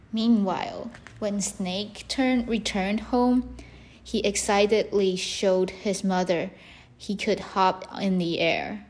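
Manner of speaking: ordinary delivery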